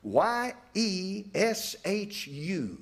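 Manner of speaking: Y E S H U, letter by letter, very slow; each letter of Y E S H U set apart; spelled out slowly. Shown as a demonstration. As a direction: spell the name slowly for the congregation. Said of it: "He's called Yeshu".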